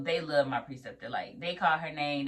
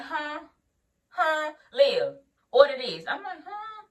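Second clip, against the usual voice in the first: confused high huh; 10 semitones higher, 5 dB louder, slower than usual. Retold or imitated. Bombarded with orders.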